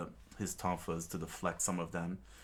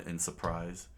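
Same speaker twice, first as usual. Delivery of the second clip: ordinary delivery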